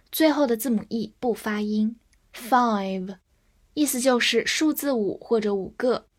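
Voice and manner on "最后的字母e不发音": ordinary talk, nothing marked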